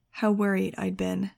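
plain reading voice

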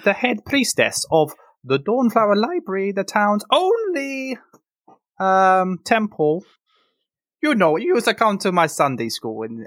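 Vandy the priestess. Acted. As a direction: reassure the patient kindly.

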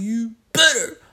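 high, loud acted warning; mock threat. Upset man warns his friend, high mock threat. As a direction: push it up high and forceful.

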